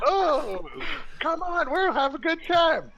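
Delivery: very high put-on voice; mocking tease. Tarhun mocking a murder tease, high mocking voice. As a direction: mimic a coaxing tease in a high voice.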